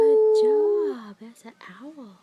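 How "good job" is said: excited praise. Real, not acted. Praising toddler.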